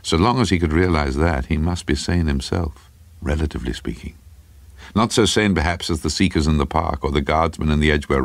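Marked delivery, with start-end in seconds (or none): none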